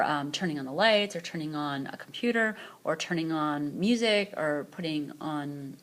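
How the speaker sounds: ordinary delivery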